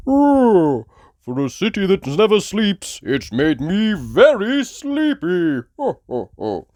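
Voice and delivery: high, slow yawning voice with chuckle; drowsy jolly dad; Daddy Pig sleepy. Acted.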